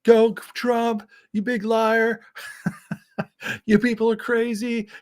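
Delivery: high, hammed-up troll heckling; mock troll voice. Imagined troll jumping into Destiny's stream, hammed-up and high. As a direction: play a ridiculous chat troll shouting at a streamer. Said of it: "jump in and be like".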